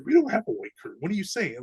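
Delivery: high, rattled protest to his wife; alarmed disbelief. Retold or imitated. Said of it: "I said".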